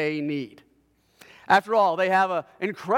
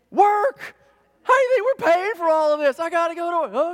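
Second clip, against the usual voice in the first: put-on higher, louder, exasperated retort to the kids; exasperated dad; 8 semitones higher, 5 dB louder than usual. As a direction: snap back like a fed-up father. Dad answering his kids.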